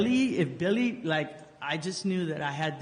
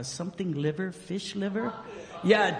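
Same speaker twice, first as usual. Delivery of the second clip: ordinary talk, nothing marked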